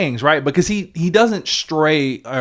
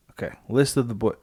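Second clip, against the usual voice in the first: normal talking voice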